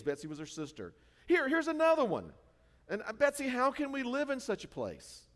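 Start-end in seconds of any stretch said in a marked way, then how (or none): 1.3-5.2 high, loud alarmed complaint; dismayed Corrie. Retold or imitated. Corrie complaining to her sister, high and loud. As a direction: voice her rising panic.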